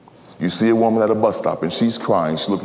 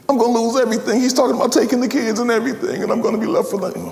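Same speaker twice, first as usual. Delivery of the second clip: higher, fast wailing woman; distraught woman; 13 semitones higher, faster than usual. Acted. Woman at bus stop lamenting her loss, high and fast. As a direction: spill it out in a rush.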